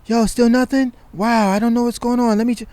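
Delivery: high, casual put-off voice; mock friendly stalling. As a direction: act the friend brushing him off again, high.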